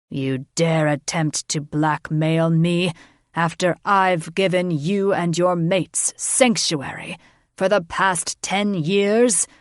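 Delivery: loud, slow, furious king; bellowing anger. A narrator's reading. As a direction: roar your outrage. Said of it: "he bellowed his anger".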